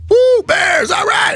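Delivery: high, loud whooping shout; drunk cheering. Retold or imitated. Fan shouting over the Bears play, whooping. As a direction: whoop into the mic.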